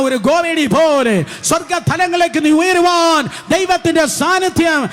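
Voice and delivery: rising preacher's cry, building to a shout; fiery revival preaching. Real, not acted.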